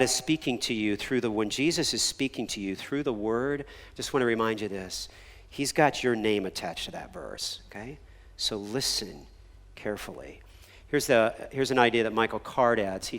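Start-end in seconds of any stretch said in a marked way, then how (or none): none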